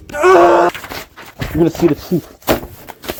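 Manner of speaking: a hammed-up furious outburst, then a high squeal; mock rage. John Adams in his fit of rage, hammed-up fury. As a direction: jump between growl and squeak like a lunatic.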